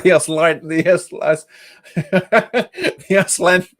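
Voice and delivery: breaking into laughter over the words; can't finish for laughing; laughing through words. Real, not acted. Joking about a chat name, words lost in laughter. Cracking up mid-sentence.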